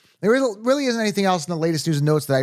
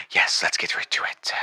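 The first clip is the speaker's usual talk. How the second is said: whisper; 10 semitones lower, 3 dB quieter than usual. Acted. Pilot voice drops to whisper.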